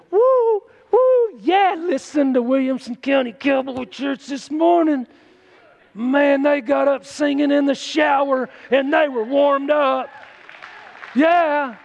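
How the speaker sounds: high, whooping shout of celebration; excited heavenly cheering. Acted. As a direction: cheer and holler like a fired-up crowd in heaven. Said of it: "they're up there going".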